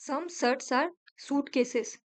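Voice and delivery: drawn-out reading of the statement; slow teacher read-through; slow dictation. Shown as a demonstration. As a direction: spell it out slowly for the class. Reads statement, then repeats it.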